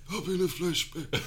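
lightly spooky ghost voice; a hint of a ghostly author; Ian Fleming ghost voice. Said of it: "No, he's more like".

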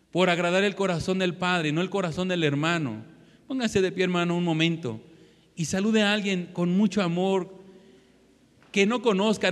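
ordinary talk, nothing marked

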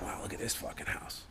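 breathless whispered wow; awed whisper. Retold or imitated. Whispering in awe at a rich friend's house. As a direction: hush it like you can't believe the house.

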